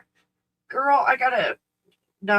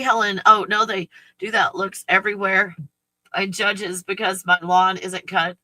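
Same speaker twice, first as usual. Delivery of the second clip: ordinary delivery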